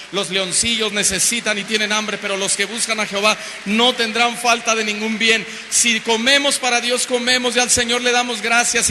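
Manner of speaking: loud, driving sermon climax, rising in pitch; impassioned preaching. Real, not acted. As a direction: preach it at full force to the whole congregation. Preaches at full force.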